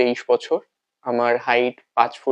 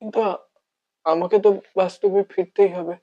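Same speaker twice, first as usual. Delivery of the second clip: slightly raised, halting monologue with long pauses; heartbroken lover; 8 semitones higher than usual. Acted. Lover resolving to start anew, wavering and heartbroken. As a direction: struggle to convince yourself, then let it sag.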